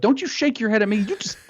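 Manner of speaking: high-pitched re-enacted scolding; retold indignant outburst; retold tirade. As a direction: rip into them, replaying the moment. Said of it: "I just ripped into it".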